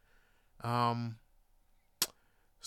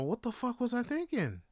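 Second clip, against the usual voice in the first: raised pitch, falling away at the end; exasperated self-reproach; 7 semitones higher, 5 dB quieter than usual. Real, not acted. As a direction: ask yourself in rueful disbelief. Exasperated at own past decision.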